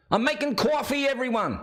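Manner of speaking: high, drawn sing-out to the room; calling out. Pretend. Host telling everyone about coffee, calling out.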